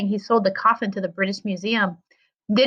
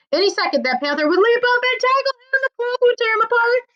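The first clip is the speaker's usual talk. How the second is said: mounting panic; 13 semitones higher than usual. A narrator's reading. Panic of the chase.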